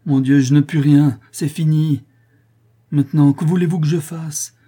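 high, loud helpless protest; desperate outcry. A narrator's reading. Son to condemned mother and sister, desperate outcry. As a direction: cry out in despair.

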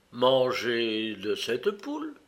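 slow question climbing at the end; puzzled. A narrator's reading. Mummy's owner asked not to eat hen, puzzled. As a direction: draw it out in baffled disbelief. Egyptian puzzled by the Indian's alarm.

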